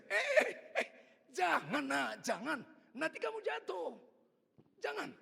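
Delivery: high, loud calls of alarm; father's scolding shout. Retold or imitated. Father catching his son sneaking off, high warning shout. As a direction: holler to stop a child.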